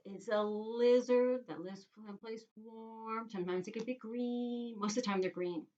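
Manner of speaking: usual speaking voice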